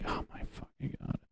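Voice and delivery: breathless whispered groan; whispered craving. Retold or imitated. Driving past fast food places, whispering in longing.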